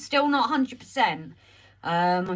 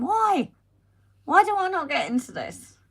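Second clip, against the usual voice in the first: a higher, louder, outraged why; indignant surprise; 10 semitones higher, 6 dB louder than usual. Real, not acted. Streamer left out of a secret, surprised protest. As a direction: protest at being left out of the secret, pitch jumping up.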